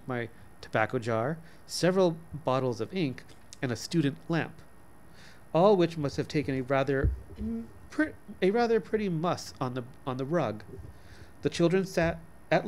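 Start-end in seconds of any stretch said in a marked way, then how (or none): none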